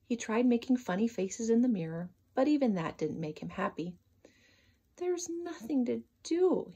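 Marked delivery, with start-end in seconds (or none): 5.0-6.7 higher, slow sighing whine; bored sigh. Acted. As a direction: sigh it out like a bored little rabbit. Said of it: "he sighed to himself".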